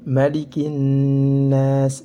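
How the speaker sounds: "Malikin nas" with a long hummed double n; chanted "Malikin nas", l merged into n; lam syamsiyah. Shown as a demonstration. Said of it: "lam syamsiyah".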